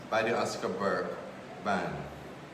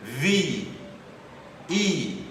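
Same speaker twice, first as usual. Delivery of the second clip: measured, louder spoken-word poem delivery; higher, projected poetry reading voice; poetry recitation; 7 semitones higher, 8 dB louder, slower than usual. Acted. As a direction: recite it line by line with deliberate pauses.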